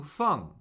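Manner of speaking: "feng" falling from high; fourth tone. Shown as a demonstration. Teacher cycling four tones on a syllable, "feng" falling. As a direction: start "feng" high and drop it quickly. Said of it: "Let's do the four tones".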